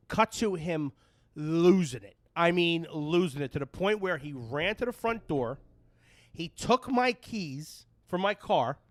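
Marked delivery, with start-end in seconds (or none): none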